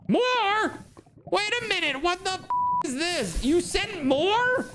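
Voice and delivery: high alarmed disbelief; shocked. Real, not acted. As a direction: yelp in shocked panic. Shocked at game enemies.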